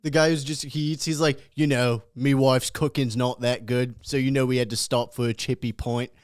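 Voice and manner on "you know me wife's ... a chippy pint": imitating a British food vlogger; British accent impression. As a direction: put on a working-class British accent. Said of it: "It's this British guy".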